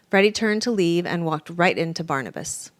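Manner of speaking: ordinary delivery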